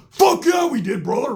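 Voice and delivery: hammed-up biker bro joy; excited yell. New biker best friends, whooping agreement.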